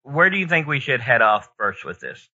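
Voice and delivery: normal talking voice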